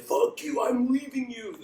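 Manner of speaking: a high, hammed-up angry breakup line; mock breakup yell. Actor in a breakup scene, hurling a fake angry goodbye. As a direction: fling it at the partner, half joking. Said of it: "or you're like".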